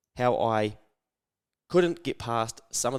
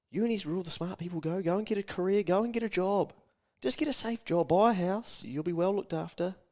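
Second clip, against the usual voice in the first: higher-pitched mock lecturing elder; sing-song higher voice of a disapproving parent; nagging grown-up; 3 semitones higher than usual. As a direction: play the killjoy adult telling a kid to play it safe. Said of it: "by the time you start getting a little bit older".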